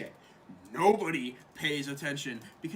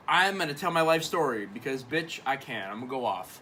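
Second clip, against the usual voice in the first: ordinary talk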